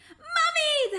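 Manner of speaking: high, loud joyful call for mum. Acted. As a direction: run to her shouting mum. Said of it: "they called".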